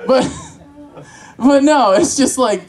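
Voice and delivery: ordinary talk, nothing marked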